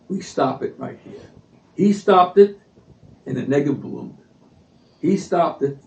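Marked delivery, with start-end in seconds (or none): 0.1-1.2 hushed resolve. Retold or imitated. Quoting Begin's resolve.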